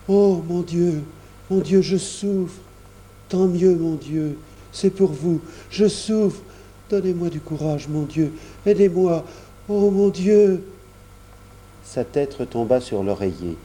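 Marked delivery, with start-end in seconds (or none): 0.1-10.6 put-on higher, pained child's voice praying through suffering; boy in pain. A narrator's reading. Roger cries out in pain.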